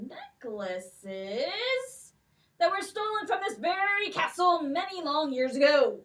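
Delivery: put-on a stately old king with a lilt, questioning; king's voice. King questions the girls about the stolen necklaces, stately.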